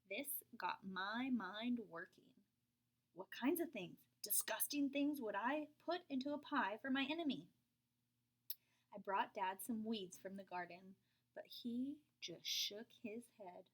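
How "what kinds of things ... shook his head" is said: usual speaking voice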